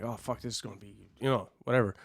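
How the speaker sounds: hushed, panicky aside to himself; nervous mutter. Retold or imitated. Rookie muttering his nerves before his first match, hushed.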